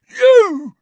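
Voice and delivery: put-on furious drunken roar; bellowed. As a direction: roar it at the intruder. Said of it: "he bellowed".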